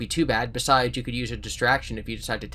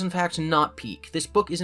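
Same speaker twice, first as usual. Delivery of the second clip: ordinary delivery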